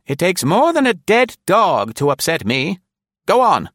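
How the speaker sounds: put-on high, loud, bossy old woman; high elderly command, loud; defiant old lady. A narrator's reading. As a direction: brush off the danger. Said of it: "insisted defiantly".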